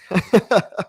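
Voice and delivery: short amused laugh at a hater. Real, not acted.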